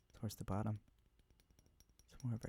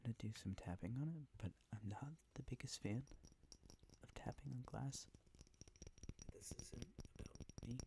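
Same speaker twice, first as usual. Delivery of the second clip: whispering; 7 dB quieter than usual. Real, not acted. ASMR whisper video.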